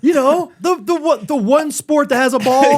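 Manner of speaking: mock the clumsy explanation; mock exasperation. Mocking the explanation.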